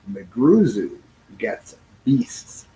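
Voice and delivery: usual speaking voice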